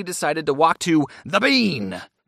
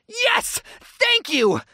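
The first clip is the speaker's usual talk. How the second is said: high-pitched overexcited fan cry; screaming with excitement; 14 semitones higher, much slower than usual. Retold or imitated. Eager audience member called on at a Q and A, screaming excitedly. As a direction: squeal it like a chosen fan. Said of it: "The guy stands up screaming".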